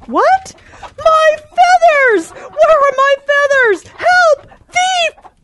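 high, alarmed cries; panicked hen. Acted. Hen waking to find her feathers stolen, crying out high in alarm. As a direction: cry out for help in a panic.

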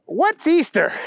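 high, innocent dim question; clueless Booger. A narrator's reading. Booger asking the bunny, high and clueless. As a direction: pipe it up high, oblivious.

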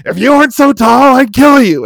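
high, loud yelled threat; threatening shout. Retold or imitated. Drunk fan threatens the tall Canadian on the platform, loud high shout. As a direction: scream the threat from arm's length. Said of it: "He's like".

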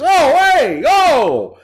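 Andrew Dice Clay. Retold or imitated. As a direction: catcall her like a Jersey mobster. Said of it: "He was Andrew Dice Clay".

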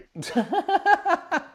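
high burst of laughter. Real, not acted. Laughing at the screen delay, high and bright. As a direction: laugh at the lag.

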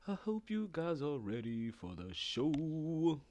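slow stretched-out announcer tease; slow drawn-out host read. Shown as a demonstration. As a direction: stretch each word out slowly. Warming up host line.

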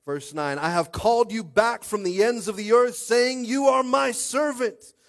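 loud, slow, rising scripture proclamation; forceful scripture reading. Acted.

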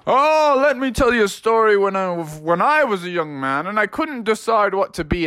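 high hooting dad voice, settling down; storytelling dad. Acted. Froggy's dad begins his story, grand and high. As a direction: launch grandly into an old reminiscence. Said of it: "Froggy's dad was like".

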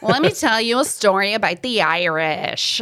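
put-on a light North American accent, storytelling; imitating a North American woman's accent. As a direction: play the North American fan telling her tale. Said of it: "try to do a North American accent".

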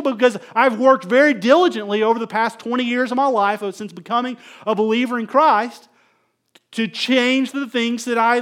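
fired-up pastor rebuking his congregation; preaching. Acted. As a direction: preach it hard from the pulpit. Pastor preaching at full force.

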